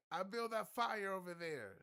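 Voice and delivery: high, loud yelled boast; mock yell. Steven bragging across ships, high loud yell. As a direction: shout the brag across. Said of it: "He's like".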